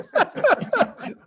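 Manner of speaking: high, loud burst of laughter; real laugh. Real, not acted. Comic cracks up over bars closing, real laughter. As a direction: crack up loudly.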